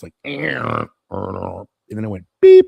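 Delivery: loud mock computer beep; imitated beep. Describing the old computer, mock beep noise. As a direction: blurt the beep sharply. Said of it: "it made a grinding noise".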